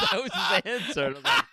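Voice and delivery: laughing hard while talking. Real, not acted. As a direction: squeal through the laughter. Laughing through the retelling.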